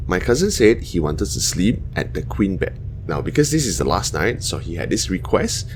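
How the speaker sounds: ordinary delivery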